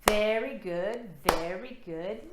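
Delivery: chanted encouragement; upbeat very good. Shown as a demonstration. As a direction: praise it like cheering a child. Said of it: "Let's try that again".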